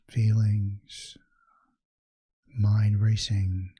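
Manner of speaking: measured, soothing meditation guide; guided meditation. Acted. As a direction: ease the listener into calm, leaving space after each phrase.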